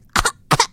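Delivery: raspy little bird cough; a bird hacking on cigar smoke; parrot cough. Retold or imitated. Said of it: "excellent parrot impression".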